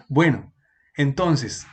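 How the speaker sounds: ordinary talk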